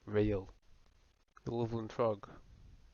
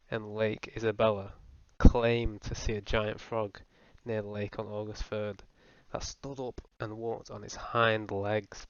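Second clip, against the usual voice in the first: ordinary talk, nothing marked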